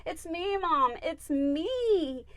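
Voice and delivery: high, insistent call to Mom; channeled pet. Acted. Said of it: "And he said".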